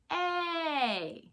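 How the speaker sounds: "A" said as the letter name; long a sound. Shown as a demonstration. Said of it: "A can say its own name".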